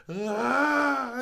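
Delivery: very high, loud mock wail; imitated wail. Imitating a friend hit by pepper spray, mock wail. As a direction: let out a long screeching howl, imitating him. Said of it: "the wail that he let go".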